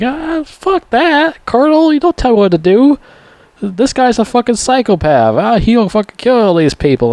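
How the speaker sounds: mock the sheriff's bluster. Sheriff snaps at the colonel, high mocking voice. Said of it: "and sheriff's like".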